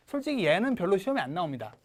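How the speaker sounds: ordinary talk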